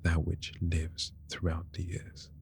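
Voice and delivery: soft, slow poetic whisper; whispered poem. Acted. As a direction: recite it softly like a wedding poem.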